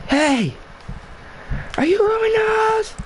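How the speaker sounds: high-pitched mock screech; screaming teacher. Teacher confronting student in the hallway, mock screaming. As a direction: imitate a teacher screeching in a shrill falsetto. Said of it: "but she was screaming".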